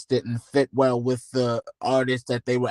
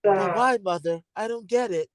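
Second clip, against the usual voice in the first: [name]; 6 semitones higher than usual. Acted. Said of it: "In my best M J voice".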